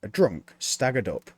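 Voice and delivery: usual speaking voice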